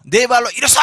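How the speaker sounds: full-voiced shouted order; loud command. Paul commanding the lame man to stand, loud shout. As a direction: shout it out with total authority. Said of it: "큰 소리로".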